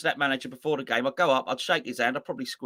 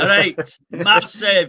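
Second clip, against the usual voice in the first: higher, loud Scottish "Massive" with a short a; 5 semitones higher, 10 dB louder, much slower than usual. Retold or imitated. A co-host tops the joke with a louder, higher Scottish accent. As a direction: belt out a hammed-up Scottish "Massive". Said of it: "Or Scottish".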